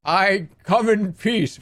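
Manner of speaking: slow sci-fi alien peace greeting. Acted. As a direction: intone it like a movie alien stepping off a ship. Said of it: "what am I, a fucking alien?".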